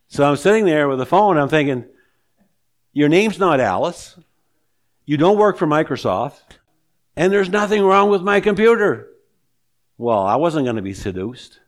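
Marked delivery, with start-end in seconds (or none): 2.9-9.1 indignant retort. Retold or imitated. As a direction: fire back in your head, loud and high. Inner retort to scam caller.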